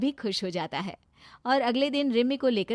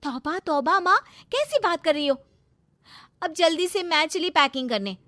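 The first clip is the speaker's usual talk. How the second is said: higher, louder, affronted protest; indignant daughter character; 8 semitones higher, 6 dB louder than usual. Acted. Daughter denies mother's suspicion of a secret lover, indignant. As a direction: brush off the accusation, pitched up.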